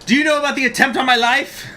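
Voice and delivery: high, loud, quick courtroom demand; outraged accuser. Acted. Judge confronting a witness in court, outraged demand. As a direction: fire the question out loud and high.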